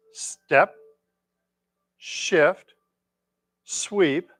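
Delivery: spaced single-word cues, calm; serene tai chi cues. Acted.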